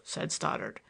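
usual speaking voice

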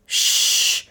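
sharp warning "shh"; imperative shush. A narrator's reading. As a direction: hush them with a commanding hiss. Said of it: "warned the unknown imperatively".

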